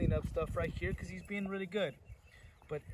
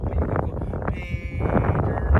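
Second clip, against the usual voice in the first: slow demo; 10 dB louder, slower than usual. Shown as a demonstration.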